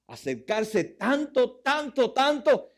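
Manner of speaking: growing preacher's stress on tanto; building emphasis. Acted. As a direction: climb on every tanto. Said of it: "repetition builds up".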